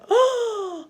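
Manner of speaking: loud, high mock gasp; big astonished intake of breath; surprised gasp. Shown as a demonstration. Teacher showing really big eyes, surprised gasp. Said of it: "when we're surprised".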